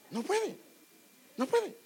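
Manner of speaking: emphatic insistence. Acted. Preacher insisting to listeners that nobody gets in, rising. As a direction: hammer it home, climbing.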